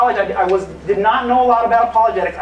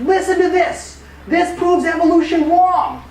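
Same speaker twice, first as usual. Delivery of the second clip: raised, insistent and slow, pointing it out; proudly declaring to a classmate; eager announcement; 9 semitones higher than usual. Retold or imitated. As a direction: call the class's attention to it, higher and slower.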